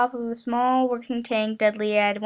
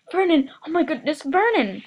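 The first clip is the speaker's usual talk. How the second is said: higher, alarmed cry of his name; shocked; 5 semitones higher than usual. A narrator's reading. Petunia shocked by letter.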